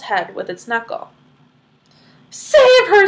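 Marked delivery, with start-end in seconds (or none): 2.3-3.1 high, loud frantic scream; screaming mother. Acted. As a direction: shriek it in panic. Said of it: "screamed Missus Salt".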